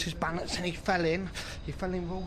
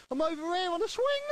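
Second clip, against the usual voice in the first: high-pitched call back from a distance; mock holler for a punchline; acted tramp's shout; 12 semitones higher than usual. Old tramp answers the man at the door, acted shouting. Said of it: "And the old tramp shouts".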